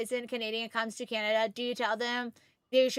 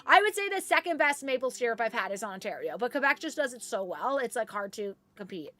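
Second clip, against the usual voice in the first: normal talking voice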